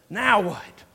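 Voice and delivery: NOW WHAT as a throwing-up-of-hands complaint; fed-up, not really asking NOW WHAT; exasperated now what. Shown as a demonstration. Preacher shows the congregation the other emphasis, exasperated. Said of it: "throwing our hands up and saying".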